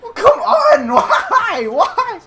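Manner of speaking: high, loud laughing whine; laughing frustration. Real, not acted.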